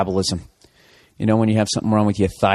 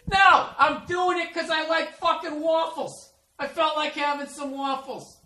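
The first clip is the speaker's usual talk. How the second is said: mock whiny reply. Boyfriend defending his waffles, high whiny mock voice. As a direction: play yourself as a whiny hurt boyfriend.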